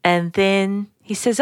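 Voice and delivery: usual speaking voice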